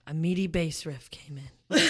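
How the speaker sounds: ordinary delivery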